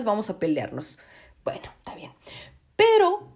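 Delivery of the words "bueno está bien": whispered under the breath; resigned whisper to herself; whispered aside. Real, not acted. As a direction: drop to a grudging whisper. Grudging aside after rant.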